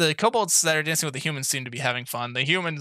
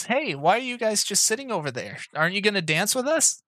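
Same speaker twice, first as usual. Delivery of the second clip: higher, cheery hand voice; hand's voice; 7 semitones higher than usual. Acted. GM voicing the hand, inviting players to dance, higher and cheery. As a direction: call them over to dance, higher. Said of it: "you hear a voice".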